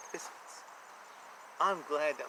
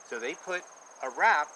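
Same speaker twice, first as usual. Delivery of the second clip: ordinary delivery, nothing marked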